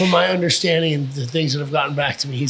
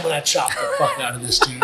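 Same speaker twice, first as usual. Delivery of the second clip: normal talking voice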